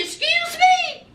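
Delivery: a high, offended "excuse me?"; indignant surprise. Retold or imitated. Mary snapping back at V in disbelief. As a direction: act shocked and insulted. Mary's indignant retort in the quarrel.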